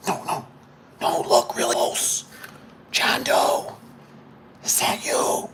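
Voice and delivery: slow, pointed whisper; mock confiding whisper. Installer showing customer his signature, theatrical whisper. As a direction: whisper it as if leaning in close.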